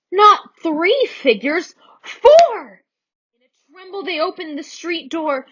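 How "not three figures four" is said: slow build to a high, loud shout; dramatic reveal. A narrator's reading. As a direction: build suspense then burst out the number.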